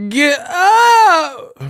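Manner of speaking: high squealed yell. Retold or imitated. Streamer copying the played clip for laughs, squealing imitation. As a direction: echo the clip in a shrieking falsetto. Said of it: "Why did [name] sound like that".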